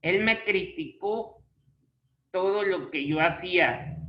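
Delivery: "criticó" as cri-ti-CÓ; stress on the final ó. Shown as a demonstration. Teacher giving an accented example, "criticó" stressed on CÓ. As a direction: land firmly on CÓ in "criticó". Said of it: "ahí también lleva".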